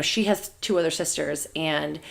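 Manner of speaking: ordinary talk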